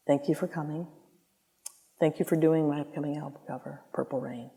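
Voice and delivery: a gentle hushed thank-you in his voice. Retold or imitated. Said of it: "never ever talked over this volume".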